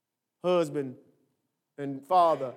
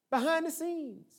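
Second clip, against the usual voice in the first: ordinary talk, nothing marked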